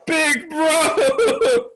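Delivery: high, wailing call; hammed-up sobbing shout; mock tearful cry. Nami's tearful relieved cry.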